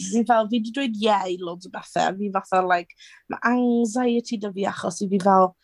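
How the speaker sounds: normal talking voice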